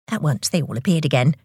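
ordinary delivery, nothing marked

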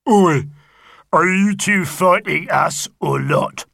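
loud, slow rough wrestler's shout; angry wrestler shout. A narrator's reading. Bone Cruncher Boggis shouting at the pair from the ring, loud. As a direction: yell down from the ropes. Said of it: "shouted Bone Cruncher Boggis".

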